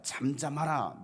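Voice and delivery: drawn-out authoritative command. Acted. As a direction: command the wind and waves. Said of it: "명령하시니까".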